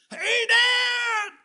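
high, loud shout across the yard; little boy yelling to his dad; child calling out. Retold or imitated. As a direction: call out to dad from far away. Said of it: "Finn goes".

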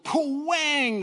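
loud, high, drawn-out pwang; imitated lightning crack. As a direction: mimic a sudden lightning strike. Imitates lightning striking tree.

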